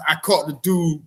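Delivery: ordinary talk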